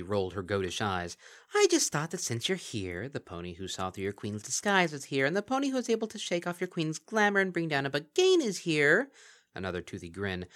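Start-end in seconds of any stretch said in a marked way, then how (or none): 1.5-9.1 high, loud, sly creature voice; mocking Kelpie. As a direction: purr false praise like a gleeful monster. Kelpie teasing the guests.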